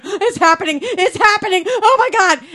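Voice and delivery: screaming excitement. Retold or imitated. Hitting whoever is next to her at the panel, shrieking fangirl excitement. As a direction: lose it completely, fangirl squeal. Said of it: "hitting whoever's next to me going".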